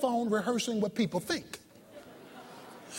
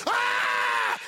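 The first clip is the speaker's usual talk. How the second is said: imitated scream; 13 semitones lower than usual. Preacher mimicking the trapped passengers' useless screaming, a mock scream. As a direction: let out a short demonstrative scream. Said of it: "The screaming".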